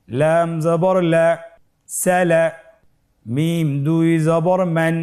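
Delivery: slow, drawn-out level chant; measured letter-by-letter chanting; recitation drill. Shown as a demonstration. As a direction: lead learners through each syllable, holding the vowels. Leads a spelling drill.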